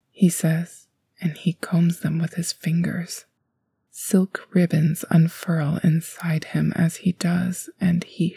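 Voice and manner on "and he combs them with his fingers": plain reading voice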